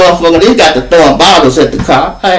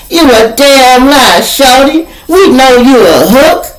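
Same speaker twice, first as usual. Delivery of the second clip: accusing dealer; 9 semitones higher than usual. Retold or imitated. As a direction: drag it out high, calling him a liar. Dealer accuses him, quarrel.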